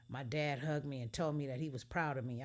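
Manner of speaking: ordinary delivery, nothing marked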